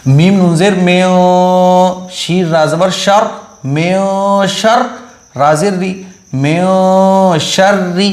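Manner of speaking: slow, drawn-out syllable-by-syllable recitation; qaida recitation. Shown as a demonstration. Teacher to students reading the qaida, slow sing-song spelling. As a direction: spell each syllable out slowly for students to follow.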